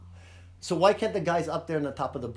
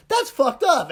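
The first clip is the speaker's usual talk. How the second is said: indignant outburst; 10 semitones higher, 7 dB louder, slower than usual. Retold or imitated. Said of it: "one of the boys is like".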